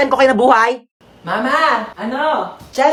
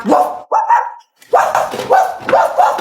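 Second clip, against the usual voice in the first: imitated barking; slower than usual. Shown as a demonstration. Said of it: "Marunong din naman ako tumahol".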